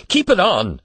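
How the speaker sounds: hurried urging. A narrator's reading. Father hurriedly stopping the old man from undressing, pressing. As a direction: urge it again, hurried and pressing. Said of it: "said Mister Button hurriedly".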